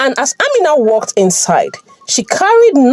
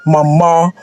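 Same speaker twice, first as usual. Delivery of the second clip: demanding; 3 semitones lower than usual. A narrator's reading. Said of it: "demanding an explanation".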